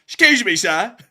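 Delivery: put-on loud, high British-accented interjection; hammed-up British call for attention. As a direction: cut in loudly as a British character. Repeats British marmot interjection.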